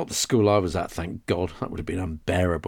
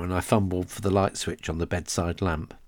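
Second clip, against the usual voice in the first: usual speaking voice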